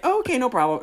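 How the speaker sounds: high customer voice. Retold or imitated. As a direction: chirp it like an easygoing customer. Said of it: "and they were like".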